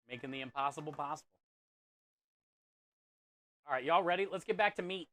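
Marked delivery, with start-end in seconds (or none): 0.1-1.3 faster, softer echo of the motto; quick quiet repeat. Shown as a demonstration. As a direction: murmur the slogan again quickly.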